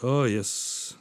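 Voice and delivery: usual speaking voice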